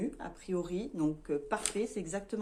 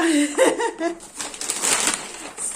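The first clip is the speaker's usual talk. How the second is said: high, loud real laugh breaking out; burst of laughter; 9 semitones higher, 8 dB louder than usual. Real, not acted. Laughing with her viewers at the pink kitchen joke, real burst of laughter.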